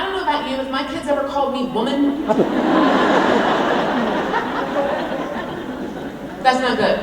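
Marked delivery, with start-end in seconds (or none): 2.3-6.4 big real laugh; burst of laughter. Real, not acted. Laughing at the thought of kids calling her woman. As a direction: laugh out loud at the idea.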